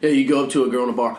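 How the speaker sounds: ordinary delivery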